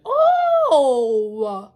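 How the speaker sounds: stunned laugh at the news; loud laughing oh. Retold or imitated. Narrator hears the betrayal, loud laughing oh. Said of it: "I'm like".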